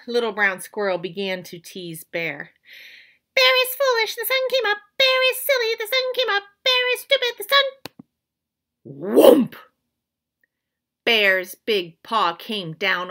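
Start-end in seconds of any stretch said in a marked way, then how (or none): 3.3-7.6 high, loud mocking squirrel singsong; high squeaky teasing chant. Squirrel teases Bear. 8.9-9.6 loud thumping whoomp; a heavy paw hitting the ground; loud whoomp sound effect; paw slam. Acted. Paw slams down.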